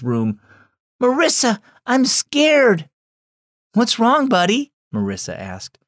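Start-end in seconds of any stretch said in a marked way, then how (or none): 1.0-2.9 put-on high, childlike scared voice; frightened little boy. Five-year-old Maverick runs to his older sister, frightened high voice. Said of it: "said in a frightened voice". 3.7-4.7 put-on high, girlish voice; concerned older sister. Older sister asks her frightened little brother, high concerned voice.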